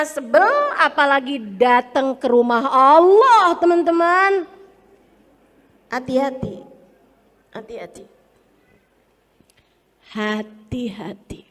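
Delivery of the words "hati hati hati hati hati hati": low, very soft caution; grave, hushed word of caution; hushed warning. Real, not acted. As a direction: let the warning sink almost to nothing. Preacher gravely warns the listeners.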